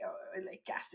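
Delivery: "Oh, il est cassé" breathed out in a whisper; resigned whispered echo; whispered repeat. Retold or imitated. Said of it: "I say".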